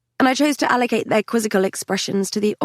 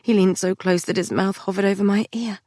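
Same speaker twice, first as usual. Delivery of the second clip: plain reading voice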